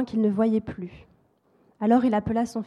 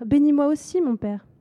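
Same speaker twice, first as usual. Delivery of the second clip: higher, louder pleading cry; crying plea; 5 semitones higher, 5 dB louder than usual. A narrator's reading. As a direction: son crying out to his father for a blessing. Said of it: "il se mit à pleurer tout haut". (supplication en pleurs, plus aiguë et plus forte)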